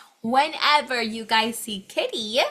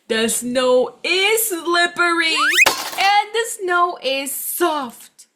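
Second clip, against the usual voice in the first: sing-song storytelling; 8 semitones higher, 9 dB louder than usual. Acted. Kitty testing snow, voiced.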